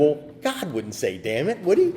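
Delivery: laughing. Real, not acted. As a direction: chuckle through the doubt. Retelling funny confusion, laughs.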